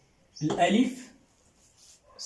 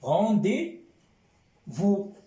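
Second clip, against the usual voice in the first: traduction lente; 3 semitones higher, much slower than usual. Shown as a demonstration. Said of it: "traduction lente pour la classe".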